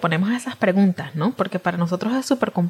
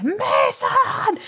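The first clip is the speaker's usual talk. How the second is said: sudden yell. Retold or imitated. Stressed mother yelling at son over brushing teeth, sudden shout. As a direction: explode from nagging into a yell. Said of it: "pasaste de la nada a los gritos".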